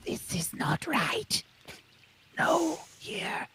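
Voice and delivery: breathy whisper, high and slow; whispery dragon voice. Acted. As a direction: hiss it like a fussy little drake. GM voices the Paper Drake.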